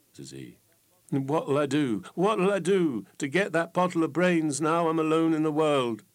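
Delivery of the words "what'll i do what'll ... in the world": despairing fool. A narrator's reading.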